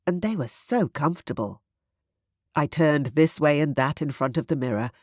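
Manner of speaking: ordinary delivery, nothing marked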